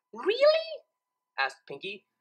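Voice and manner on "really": much higher thrilled question; gleeful. A narrator's reading. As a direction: squeal with glee. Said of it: "eyes sparkled with glee".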